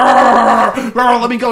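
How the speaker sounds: loud, growly engine revs turning into words; impatient revving car. Retold or imitated. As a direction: roar like a car straining to go. Voicing the lurching car.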